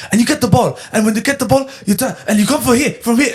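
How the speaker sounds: high, hammed-up broken-English coach; mimicking Pep Guardiola at the whiteboard; mock coach yelling.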